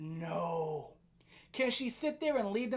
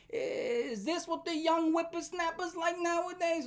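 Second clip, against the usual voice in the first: mock old man; 5 semitones higher, 3 dB quieter than usual.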